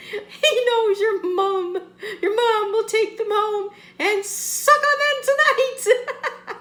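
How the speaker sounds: high, loud, slow words through laughter; laughing hard. Real, not acted. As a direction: force it out while cracking up. Cracking up at own joke.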